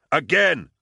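sharp loud shout; yelled command. A narrator's reading. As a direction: yell it out as an order. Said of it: "Gaunt yelled".

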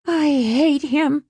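put-on high, slow teenage groan. A narrator's reading. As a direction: groan it out in misery. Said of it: "Alice groaned".

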